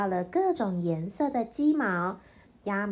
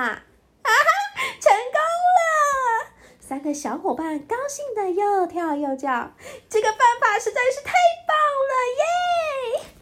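shrieking high with joy; cheering; 13 semitones higher, 4 dB louder than usual. A narrator's reading. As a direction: jump and squeal in triumph.

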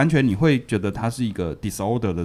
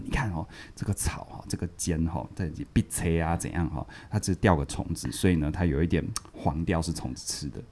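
hushed, softer secret-telling voice; conspiratorial hush; 5 semitones lower, 9 dB quieter than usual. Retold or imitated. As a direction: let her in on a big secret, quietly. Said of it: "煞有其事的跟女老师说".

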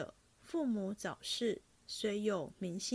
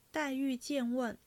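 plain reading voice

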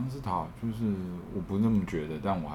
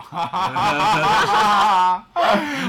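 burst of laughter; 13 semitones higher, 11 dB louder than usual. Real, not acted. Laughing at own remark.

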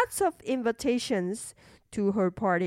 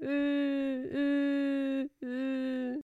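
quiet whimpering sobs; soft sobbing; 2 semitones higher, 7 dB quieter, much slower than usual. Acted. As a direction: sob softly like a little girl. Princess sobbing after question.

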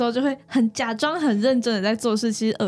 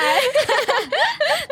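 high burst of laughter; 14 semitones higher than usual. Real, not acted. Co-host laughing at the teasing. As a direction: laugh freely.

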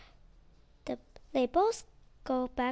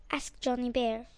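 ordinary delivery, nothing marked

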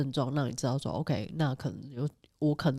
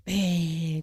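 ordinary talk, nothing marked

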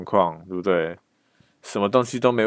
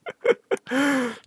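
a sudden high laugh; high laugh after answering the officer. Real, not acted. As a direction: laugh it off, amused.

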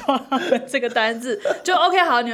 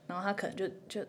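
normal talking voice

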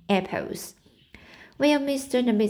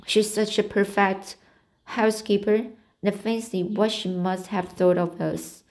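ordinary delivery, nothing marked

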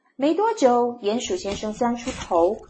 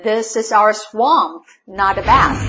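snapping; 7 dB louder than usual. A narrator's reading. Crocodile to the bathing mole, snapping. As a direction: snap it out, word by word. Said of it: "snapped Crocodile".

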